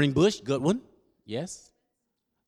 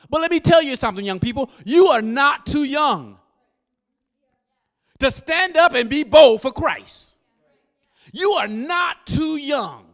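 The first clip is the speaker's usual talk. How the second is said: preaching shout; 9 semitones higher, 8 dB louder than usual. Real, not acted. Preacher urging young people in a rising, loud shout. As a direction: drive it home to the congregation at full force.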